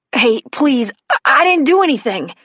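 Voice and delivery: put-on high, stammered teen plea; pleading. A narrator's reading. Caught boy to the cop who grabbed him, high-pitched pleading. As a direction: plead innocence, pitch jumping up. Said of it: "Jason pleaded".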